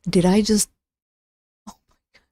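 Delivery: ordinary delivery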